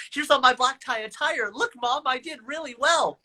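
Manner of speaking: put-on like a kid showing Mom his work; proud eager teen. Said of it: "I go".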